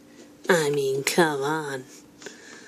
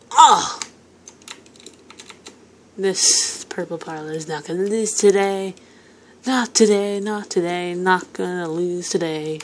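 ordinary talk

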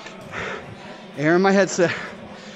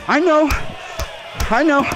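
higher, sing-song I know; sing-song agreement; 7 semitones higher than usual. Real, not acted. Vlogger teasing his female viewers, higher sing-song. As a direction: tease them along knowingly. Mock sympathy to women.

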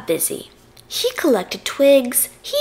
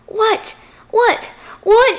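high shouts building louder; exasperated shout; 9 semitones higher, 6 dB louder, slower than usual. A narrator's reading. Fox loses patience with the stalling piglet, shouting. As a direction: shout each one harder, pitched up. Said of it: "shouted the fox".

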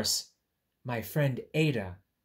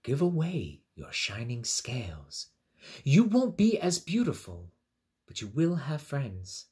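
wise octopus voice; 3 semitones higher, 6 dB louder than usual. Acted. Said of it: "The octopus said".

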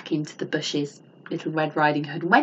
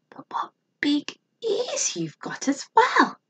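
whispered wonder; 8 semitones higher than usual. A narrator's reading. Girl peering at disguised wolf.

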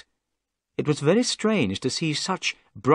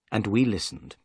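plain reading voice

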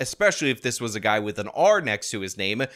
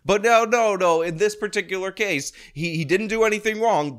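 raised-pitch, sarcastic denial; mock protest; 7 semitones higher than usual. Host parrots commentator's denial of flirting, mock-protesting. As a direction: parrot his excuse in a higher mocking voice.

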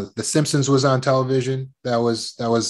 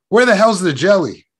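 put-on loud, high kid trying out a swear; higher and louder, pushing the boundaries; five-year-old testing boundaries; 5 semitones higher, 6 dB louder than usual. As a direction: blurt it out like a cheeky child. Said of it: "Picture me, five years old".

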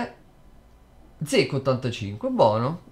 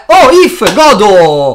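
excited shout; 6 semitones higher, 14 dB louder, much slower than usual. Real, not acted. Big pack pull, celebrating.

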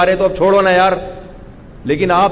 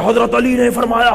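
high, strained oratorical cry, far from normal voice; forceful preacher's bellow; 6 semitones higher than usual. Retold or imitated. Speaker mimics a cleric thundering at his audience, forceful bellow. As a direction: belt it out like an overzealous cleric. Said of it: "اتنا زور تھے".

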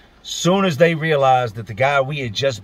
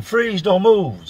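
higher, slow drawn-out mock police order; retold cop yell; 7 semitones higher, 3 dB louder, much slower than usual. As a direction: stretch out the cop's command, pitched up. Cops order freeze again.

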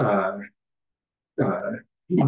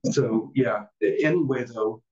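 ordinary talk, nothing marked

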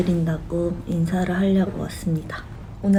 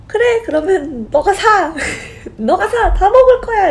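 much higher and louder, laughing through it; laughing mock bravado; 12 semitones higher, 7 dB louder than usual. Telling her younger bandmate fine, you pay, laughing loudly. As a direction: cave in with gleeful greed. Giving in to [name] treating her.

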